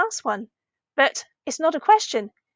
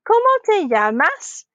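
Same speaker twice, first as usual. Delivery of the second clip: "Cómo te llamas" high, dipping then swooping up on "llamas"; "Cómo te llamas" as an exaggerated question; rising question; 6 semitones higher, 5 dB louder, slower than usual. Shown as a demonstration. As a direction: ask "Cómo te llamas" with a big lift at the end. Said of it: "My voice went up at the end".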